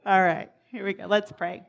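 ordinary delivery

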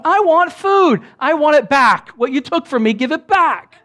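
high, loud demanding tantrum; carnal nature. Acted. Carnal nature wanting its food back, demanding. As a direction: play the flesh yelling its demands. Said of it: "That's your carnal nature going".

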